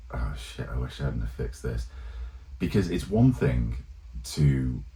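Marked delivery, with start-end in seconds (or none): 0.1-1.9 muttered under the breath, much softer and a bit lower; a quiet, sinking oh-no to himself; dismayed mutter. Retold or imitated. Imagined thought of the player.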